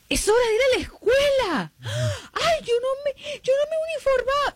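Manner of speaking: high, hurried fake alarm; mock urgent. Teasing big sister rushes her little sister in mock panic.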